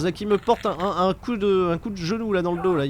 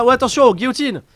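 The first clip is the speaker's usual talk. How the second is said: excited commentary; 8 semitones higher, 8 dB louder than usual. Real, not acted. Calling a submission hold.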